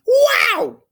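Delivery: very high, loud exclaimed wow; delighted shout. Acted. Teacher overwhelmed by the note and snacks, shouted wow. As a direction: burst out in amazement.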